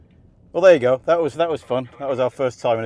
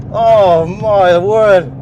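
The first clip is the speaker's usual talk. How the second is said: slow, drawn-out, louder amazed cry; excited; 6 semitones higher, 10 dB louder, much slower than usual. Real, not acted. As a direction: stretch it out in amazement. Thrilled driver on hot lap.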